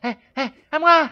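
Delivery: loud high call for help; shouting. Real, not acted. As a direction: yell it out, wanting a turn.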